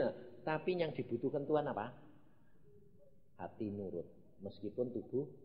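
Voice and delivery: usual speaking voice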